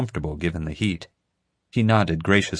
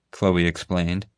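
usual speaking voice

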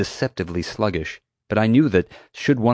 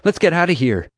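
high, louder hurrying voice; pressing, raised-voice call; urgent shout; 9 semitones higher, 5 dB louder than usual. A narrator's reading. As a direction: urge a friend to flee. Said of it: "she shouted".

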